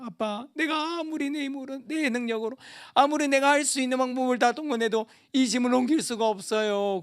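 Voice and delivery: a light hint of a pleading little boy; crying child. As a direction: lift the voice slightly like a small son. Child voiced in parable.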